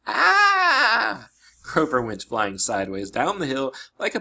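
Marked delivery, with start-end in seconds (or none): 0.1-1.3 high, loud drawn-out cry; long panicked scream. Acted. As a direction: scream as if careening out of control. Goat boy flies off out of control.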